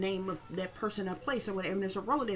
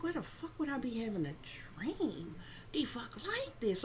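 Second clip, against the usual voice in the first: whispered; 2 semitones higher, 7 dB quieter than usual. Retold or imitated. Questioning the nightmare to herself, in a whisper. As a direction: whisper it to yourself, baffled. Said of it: "I'm like".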